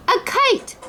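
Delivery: high squeal of delight; a small child spotting a kite; excited child. A narrator's reading. Roo sees kite.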